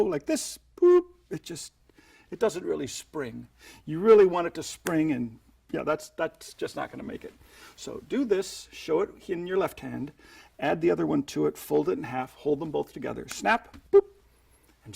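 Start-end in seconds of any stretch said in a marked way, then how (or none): 0.8-1.7 high boop sound effect. Shown as a demonstration. Ball springing sound effect. 13.3-14.0 high snap boop. Shown as a demonstration. Magician voicing the ball springing, high playful boop.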